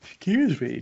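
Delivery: high-pitched words through a yawn; stifled yawn stretching the words. Real, not acted. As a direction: talk through a real yawn. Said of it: "just yawning right into the mic".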